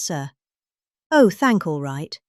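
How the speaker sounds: usual speaking voice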